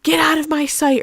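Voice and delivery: light imitation of yelling; mock yell. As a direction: retell a mother's yell without real volume. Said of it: "I think that she yelled at him".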